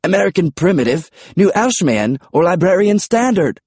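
British pterodactyl, pitched high; high British character voice; British accent. A narrator's reading. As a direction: ask as a very British dinosaur. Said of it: "British accents were unexpected".

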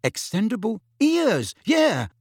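high incredulous repeat of Extendable ears; incredulous echo. A narrator's reading. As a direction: echo it back in disbelief. Harry echoes the gadget name.

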